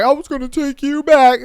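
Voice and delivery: mock ex. Mocking the ex claiming he'd take her back, lightly whiny. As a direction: lightly sell the fake excuse. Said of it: "he's just like".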